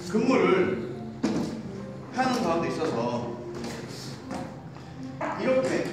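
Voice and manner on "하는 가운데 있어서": normal talking voice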